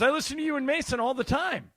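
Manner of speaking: high, loud excited quote; retold fan enthusiasm. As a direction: gush like a keen listener meeting the host. Retold star gushing as a fan.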